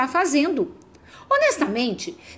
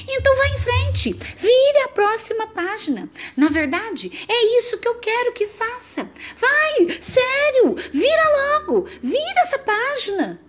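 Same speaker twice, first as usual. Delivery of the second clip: higher, sweetly fake egging on; mock encouraging; 9 semitones higher than usual. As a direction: pretend you want them to turn it, much higher. Fake encouragement to trick reader.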